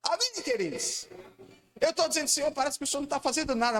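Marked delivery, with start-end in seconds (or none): 1.8-3.8 high, fast complaining plea; a believer crying out that God does nothing; lament to God. Acted. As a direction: pour out the complaint quickly and high.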